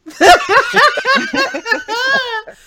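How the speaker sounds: high loud cackling laugh; loud burst of laughter. Real, not acted. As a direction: crack up loudly. Laughing at Mitch remark.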